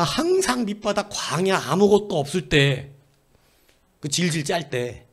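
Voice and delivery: drawn-out, slowed-down list of hardships; slow emphatic list. Real, not acted. Slowing on low points.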